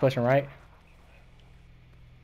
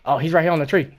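loud urgent enemy callout, higher; excited callout; 6 semitones higher, 13 dB louder than usual. Real, not acted. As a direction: shout where the enemy is. Spotting enemy mid-fight.

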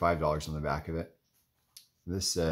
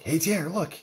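higher-pitched retold call for attention; mock calling out; 10 semitones higher, 4 dB louder than usual. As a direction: imitate someone eagerly calling a friend over.

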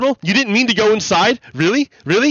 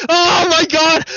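incredulous; 10 semitones higher, 5 dB louder, slower than usual. Real, not acted. Disbelief at protest claim.

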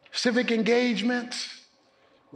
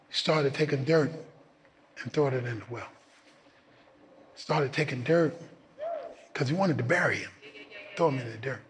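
low, measured storyteller voice; parable telling; 6 semitones lower than usual. Acted. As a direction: ease into the story.

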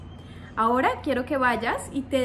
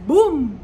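loud boom; 8 dB louder than usual. Shown as a demonstration. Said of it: "pensar en un ejemplo". (boom fuerte como explosión)

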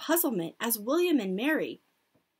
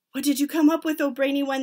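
higher, loud eager question; called out; 4 semitones higher, 7 dB louder, faster than usual. A narrator's reading. Witches questioning their leader, called out. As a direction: call it up to the speaker. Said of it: "they called out".

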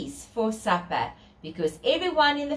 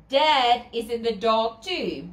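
ordinary delivery, nothing marked

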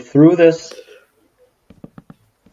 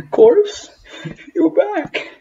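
very high squeaky greeting; much slower than usual. Retold or imitated. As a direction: squeak it out happily.

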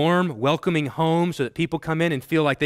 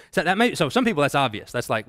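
usual speaking voice

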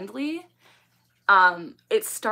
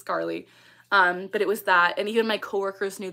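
usual speaking voice

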